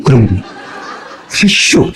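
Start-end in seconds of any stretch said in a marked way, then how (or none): 1.3-1.9 high two-syllable mock Chungcheong dialect. Shown as a demonstration. Preacher shows the brief death announcement in Chungcheong dialect. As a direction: say it as a Chungcheong local, pitched up. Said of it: "충청도는 간단해".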